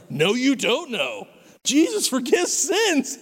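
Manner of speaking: rising to a squeaky, high, insistent pitch; insistent high squeal. Retold or imitated. Correcting his classmates, high and insistent. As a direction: squeal it like you can't believe they don't get it. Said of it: "And I was like".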